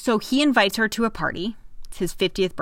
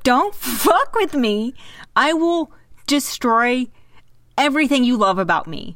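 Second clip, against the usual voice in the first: fierce warning; 5 semitones higher, 4 dB louder than usual. Retold or imitated. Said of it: "She was like".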